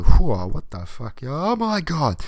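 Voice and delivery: slow loud shocked whoa; loud, drawn-out mock shock; shocked dad. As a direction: recoil in mock horror. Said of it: "see it and go".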